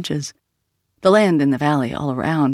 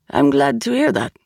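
lightly edged, higher-pitched monsignor; edge in voice; 6 semitones higher than usual. A narrator's reading. Monsignor answering a researcher, a slight edge in his voice. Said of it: "an edge in his voice".

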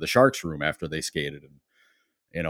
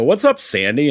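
higher, louder mock-casual needle; teasing greeting; 8 semitones higher, 6 dB louder than usual. Greeting Rob with the nickname to needle him. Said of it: "I go".

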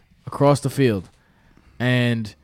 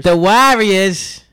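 put-on slow, high Boston accent drawl; mocking Boston accent, much higher and louder; 7 semitones higher, 7 dB louder, much slower than usual. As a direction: stretch it out in a thick Boston accent. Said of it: "that accent is horrendous".

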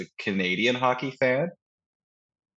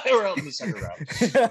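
high, laughing taunt; laughing mockery; 10 semitones higher than usual. Fan joking about Oilers playoff exits, laughing mockery. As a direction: deliver it through a laugh. Mocking fans while laughing.

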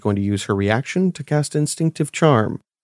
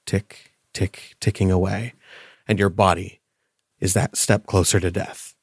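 game master narration; 4 semitones lower than usual. A narrator's reading. GM narrates rapid aging.

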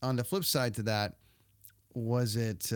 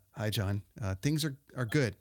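ordinary talk, nothing marked

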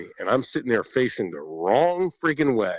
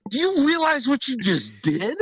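mock exasperated dad. Angry dad confronting his son.

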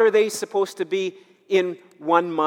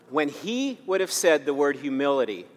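usual speaking voice

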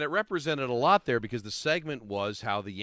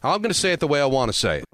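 defiant; 2 semitones higher, 7 dB louder than usual. Real, not acted. Defiant reply in quarrel clip.

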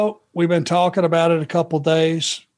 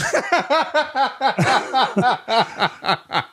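bursts into a high laugh; can't hold back a laugh; real laughter; 7 semitones higher than usual. Real, not acted. Host cracks up telling the shortcake story.

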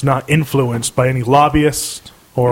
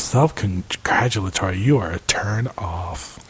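sultry voice; 7 semitones lower, 8 dB quieter than usual. Pretend. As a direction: purr it like a seductress. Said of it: "This is my sultry voice".

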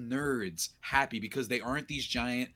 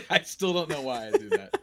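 laughing; 5 semitones higher, 6 dB louder than usual. Real, not acted. Laughing at own name habit.

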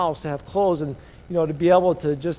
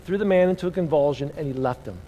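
normal talking voice

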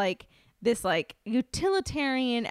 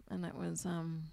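softer, lower mock resignation; contrite contestant; 3 semitones lower, 7 dB quieter than usual. Baker telling judges he should go home, softly contrite. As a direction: take the blame quietly, halting. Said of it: "he's like".

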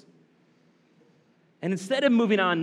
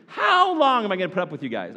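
louder, exasperated complaint; frustrated Jesus; 3 semitones higher, 6 dB louder than usual. Acted. Jesus fed up with the disciples, exasperated. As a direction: sigh it out like a weary dad. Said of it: "Jesus get a little frustrated".

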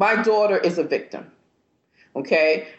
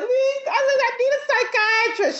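desperate high-pitched plea; high tearful plea; much faster than usual. Retold or imitated. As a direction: beg through tears. Retelling call while crying.

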